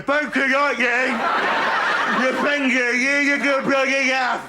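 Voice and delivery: put-on high, garbled child wail; mock toothless kid, words mangled; injured little brother. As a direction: blubber it as if the mouth is hurt. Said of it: "Paul's going".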